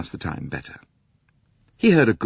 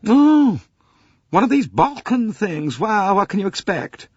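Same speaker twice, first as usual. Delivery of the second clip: high, dismissive voice of a fussy matron; fussy older lady; 7 dB louder than usual. Acted. Older lady to Poirot, high and dismissive. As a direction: brush off the Balkans as beneath her. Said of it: "she said".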